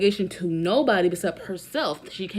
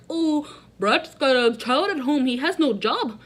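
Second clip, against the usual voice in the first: higher, louder mocking imitation of Kyle; 5 semitones higher, 5 dB louder than usual. Mimicking Kyle's excuse with a bad mock accent. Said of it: "Bad accent".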